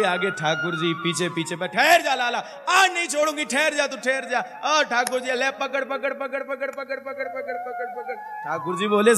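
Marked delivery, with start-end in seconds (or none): 1.6-4.6 high cry of a woman in pursuit; chasing cry. Acted. Gopi chasing Kanha. 4.6-8.1 high calls of catch growing softer; the chasing gopi's calls fading into the distance; fading chase. Acted.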